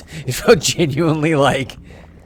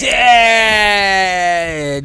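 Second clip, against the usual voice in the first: shocked cry; 5 semitones higher than usual. Real, not acted. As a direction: blurt it out in disbelief. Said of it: "You, you said, "I've never died".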